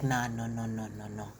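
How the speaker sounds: low, soft imitation of a man refusing; hushed low string of refusals; mock denial. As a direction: drop low and repeat it, fading away. Voices Rasset's denial.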